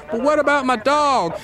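high-pitched pleading question; worried dog owner. Acted. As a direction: plead after your dog. Owner worries about his dog.

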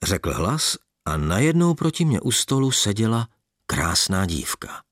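ordinary delivery, nothing marked

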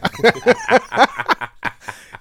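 high, ringing real laugh; hearty laughter. Real, not acted. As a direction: burst out laughing at the memory. Laughing at remembered joke.

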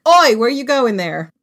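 high, loud challenge to a passer-by; shouted British oi. Shown as a demonstration. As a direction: call after someone to stop them. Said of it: "It's more like".

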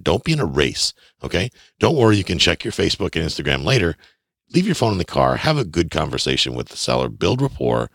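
usual speaking voice

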